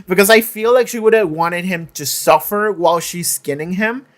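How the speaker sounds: ordinary talk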